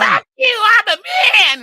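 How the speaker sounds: mock her in a screeching yell; shrill mocking shout. Uncle mimicking the niece's outburst, high mocking shout.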